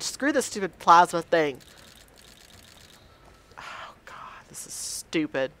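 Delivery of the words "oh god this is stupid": hushed frustrated whisper; whispering to himself, fed up. Real, not acted. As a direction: breathe it out under your breath. Muttering in frustration.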